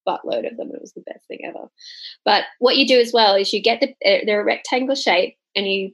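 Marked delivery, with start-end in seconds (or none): 0.1-1.7 breathless hushed confession of delight; unvoiced, hushed gushing; whispered aside. Real, not acted. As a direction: whisper it like a secret. Confiding aside about free biscuits.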